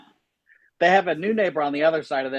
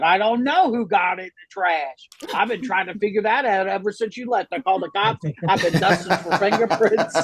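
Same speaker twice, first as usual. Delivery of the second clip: higher-pitched, overdone innocent denial; guilty dog; 5 semitones higher than usual. Acted. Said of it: "the guilty dog will be like".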